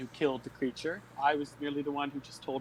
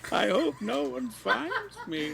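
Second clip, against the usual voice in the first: high, slow granny through laughter; giggling frail old lady; old woman voice; 7 semitones higher, slower than usual. Acted. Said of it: "look like an old woman".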